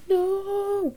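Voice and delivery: sudden high mock-scared no. Shown as a demonstration. Acting coach demos a frightened cry.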